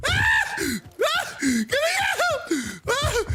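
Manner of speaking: panicked screams; yelling in fright; being chased. Real, not acted. Screaming while chased in game.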